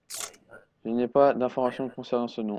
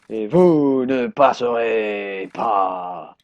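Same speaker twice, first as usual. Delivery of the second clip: very slow, louder, booming decree; solemn wizard decree; 7 dB louder, much slower than usual. Pretend. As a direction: pronounce it like an epic gatekeeper. Guard's grand refusal. (décret solennel, très lent et plus fort)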